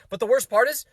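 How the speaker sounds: ordinary talk, nothing marked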